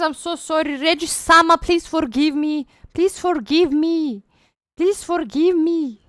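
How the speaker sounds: high, loud hammed-up begging; mock pleading. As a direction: beg dramatically for forgiveness. Begging after skipped request.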